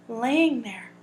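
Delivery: usual speaking voice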